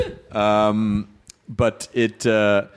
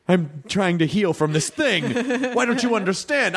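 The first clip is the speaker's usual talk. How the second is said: high, exasperated pleading, played for laughs; hammed-up wounded protest; mock meltdown plea; 9 semitones higher than usual. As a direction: plead as if at the end of your rope, pitch climbing. Acts out meltdown at a fan.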